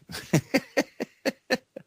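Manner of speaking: squeaky high burst of laughter; a real giggle at the stumble. Real, not acted.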